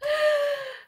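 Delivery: sudden high-pitched embarrassed giggle. Real, not acted. Embarrassed over misreading a name. (tawa kecil bernada tinggi)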